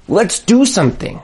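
normal talking voice

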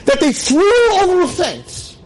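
high, slow, rising grief; anguished high cry. Real, not acted. Preacher crying out how abused Ruth was, high and anguished.

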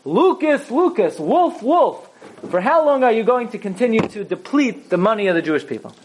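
high, taunting jeer at the altar; girl kicking the altar and mocking it; mocking insult. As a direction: sneer the insult with scorn. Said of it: "she said the following insult".

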